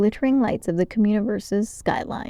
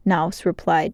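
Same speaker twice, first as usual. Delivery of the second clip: usual speaking voice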